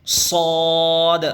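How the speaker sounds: صاد held long, heavy and full; teacher chanting a heavy صاد; full-mouthed letter. Shown as a demonstration. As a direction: fill the mouth and draw out صاد. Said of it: "منہ بھر کے".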